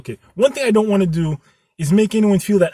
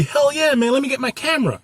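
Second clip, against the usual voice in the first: eager yes; 9 semitones higher than usual. Pretend. Eager Xavier voiced.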